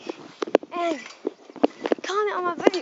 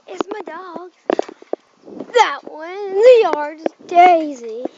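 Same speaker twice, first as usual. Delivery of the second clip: ordinary delivery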